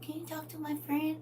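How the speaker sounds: put-on high, soft childish asking; child's plea. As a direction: plead sweetly like a child. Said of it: "My kids".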